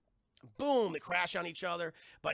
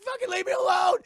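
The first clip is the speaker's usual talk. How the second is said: mock shout; 14 semitones higher, 12 dB louder than usual. Heroine yells at villains in recap.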